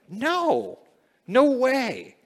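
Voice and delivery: high, loud "No, no way"; preacher's sharp rising rejection; emphatic no. Real, not acted. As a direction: shoot the idea down hard. Preacher refuting sinful excuse.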